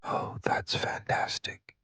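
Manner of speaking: ordinary delivery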